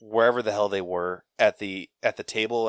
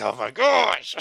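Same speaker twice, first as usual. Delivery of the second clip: exasperated; 8 semitones higher, 5 dB louder than usual. Retold or imitated. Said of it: "And I'm like".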